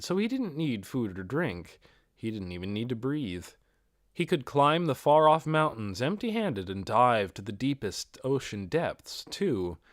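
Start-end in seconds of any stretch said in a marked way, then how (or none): none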